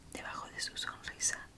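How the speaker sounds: ordinary delivery, nothing marked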